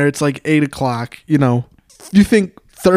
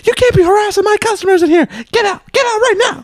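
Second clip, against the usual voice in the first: very high falsetto scolding, quick at first; mock irate manager; faster than usual. Manager scolds man for harassing customers, mock irate falsetto. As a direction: put on a manager throwing someone out. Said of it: "so she's like".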